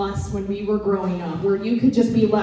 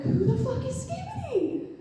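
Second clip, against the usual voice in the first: baffled mom; 6 dB quieter than usual. Retold or imitated. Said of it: "I'm like".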